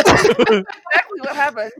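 laughing. Real, not acted. Laughing agreement.

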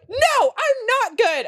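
high, drawn-out wail of protest; imitated cracking under pressure, way up high; mock meltdown. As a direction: stretch it out in a hammed-up outburst. Retold outburst when asked if okay.

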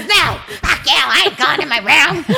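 high, indignant mock yell back; retold yelled refusal. Housemate refusing to go to her room, imitated as yelling. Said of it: "And it's just loud back and forth".

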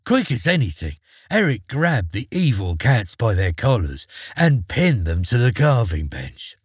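ordinary delivery, nothing marked

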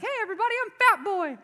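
very high, loud put-on party entrance; gullible kid announcing himself. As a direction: belt it out like an eager little boy. Acts his childhood entrance at party.

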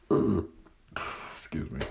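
talking through a burp; small burp mid-word. Real, not acted. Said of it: "little burp".

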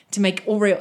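ordinary delivery

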